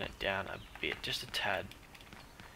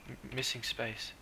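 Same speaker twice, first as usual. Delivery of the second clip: ordinary talk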